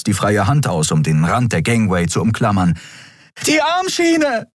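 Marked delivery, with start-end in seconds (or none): 3.5-4.5 very high, drawn-out scream. A narrator's reading. Andriel yelling back to Kai who cannot hear, screaming. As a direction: scream it over roaring engines. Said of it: "schrie Andriel".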